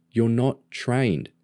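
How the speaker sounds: slow, insistent "You're not trained"; firm refusal. Acted. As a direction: spell out "not trained" slowly and firmly. Brother refuses to take sister.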